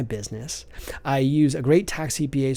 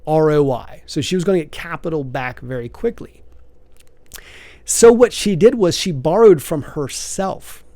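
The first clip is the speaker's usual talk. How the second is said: usual speaking voice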